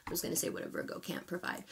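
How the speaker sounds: quick, hushed, almost unvoiced aside; muttered aside. Real, not acted. Astrologer owning up to a slip, quick muttered aside. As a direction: drop to a fast, very soft mumble.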